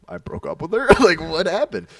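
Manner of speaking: retold baffled protest with a laugh; incredulous. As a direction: question them, voice jumping up. Acted disbelief at friends' accusation.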